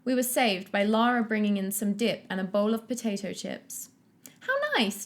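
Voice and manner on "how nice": high bubbly gush; bubbling. Acted. Julie greeting the dip at the party, high bubbly gush. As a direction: squeal it with delight. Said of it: "Julie bubbled".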